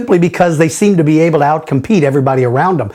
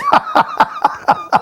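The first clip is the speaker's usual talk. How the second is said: laughing; 3 dB quieter than usual. Real, not acted. Host tickled by a fan's accent request, laughing. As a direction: burst of chuckling.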